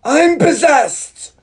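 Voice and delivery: very high, loud, drawn-out yell; hammed-up movie-line scream. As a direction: belt it out in mock hysteria. Performs favourite line from the film.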